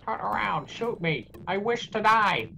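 high, hammed-up plea for death; mock screaming. Game enemy begging the player to shoot, mock screaming. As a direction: cry out like a tormented game enemy.

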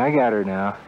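ordinary delivery

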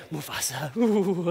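hushed, low, then a shivery ooh; hyena's shudder. Acted. Hyena shivering at the lion's name, hushed. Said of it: "if you're a hyena".

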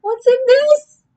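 high, loud, slow suspicious question. Shown as a demonstration. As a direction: peer at the food in high suspicion, slowly. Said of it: "Let's look at it again".

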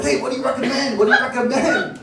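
giddy tourist asking the waiter what's good; eager customer. Retold or imitated. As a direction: play the overexcited customer asking for tips.

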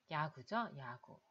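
ordinary talk

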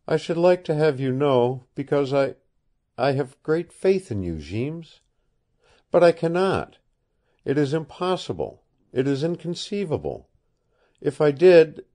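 a hint of an earnest girl pleading; young woman. Woman confides to Kent.

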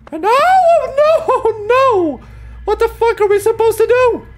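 high panicked yelling; panicked screaming. Real, not acted. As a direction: scream in terror while chased. Panicked while chased by monster.

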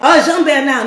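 ordinary talk, nothing marked

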